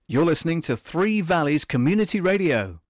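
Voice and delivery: loud, upbeat station ident; radio announcer. Acted. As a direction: punch it like a radio jingle.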